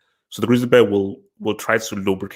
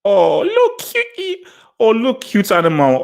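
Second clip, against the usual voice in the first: mock cooing; 10 semitones higher, 5 dB louder, slower than usual. Mock cooing over honey badger.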